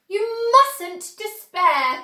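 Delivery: high, slow, loud cry; cried out. A narrator's reading. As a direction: call it out slowly to cheer them. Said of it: "cried Mister Wonka".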